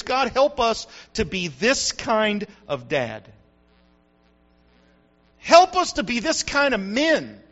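slow, earnest prayer. Acted. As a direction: lift the words up to God slowly. Prays for fathers.

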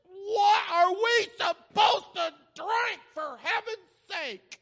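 high, hammed-up whiny outrage; upset grumbling. Israelites grumbling at Moses for water, exaggerated upset. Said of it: "They were so upset at Moses".

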